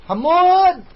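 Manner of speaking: loud, high call across the street. Real, not acted. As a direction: call his name from afar. Calling his friend over. (ينادي صديقه بصوت عالٍ)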